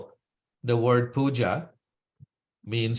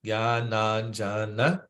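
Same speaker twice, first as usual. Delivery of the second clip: "Gyananjana" slowed into separate syllables; broken into syllables; slower than usual. Shown as a demonstration. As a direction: take "Gyananjana" apart syllable by syllable. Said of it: "So we break it down into syllables".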